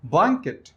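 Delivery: "Blanket" with BLAN pitched high, ket falling away; stress on first syllable. Shown as a demonstration. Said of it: "accent on the first syllable".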